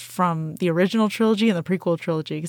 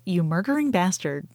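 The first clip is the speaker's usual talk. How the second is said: usual speaking voice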